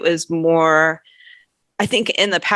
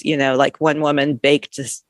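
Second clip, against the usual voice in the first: ordinary talk, nothing marked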